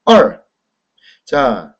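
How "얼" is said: 얼 loud, falling sharply from high; fourth-tone demo. Shown as a demonstration. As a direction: drop 얼 steeply in Mandarin fourth tone. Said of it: "사 성이네요".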